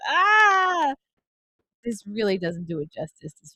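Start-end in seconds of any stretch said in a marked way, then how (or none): 0.1-0.9 high mock scream on the coaster; short high-pitched cry of ride panic. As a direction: let out a playful rider's scream. Reliving the coaster getting worse.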